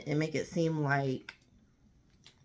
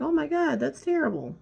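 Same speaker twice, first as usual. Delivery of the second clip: mock sympathy; 11 semitones higher, 4 dB louder than usual. Mock sympathy to her news.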